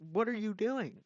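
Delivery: exasperated. Acted. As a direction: throw it out in loud disbelief. Exasperated question at the blunder.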